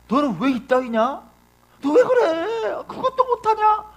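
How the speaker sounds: high-pitched, hammed-up berating; mock scolding. Preacher plays Type A picking on others' faults, high mock scolding. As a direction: pitch it way up in exaggerated fault-finding. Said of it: "단점에 주목해요".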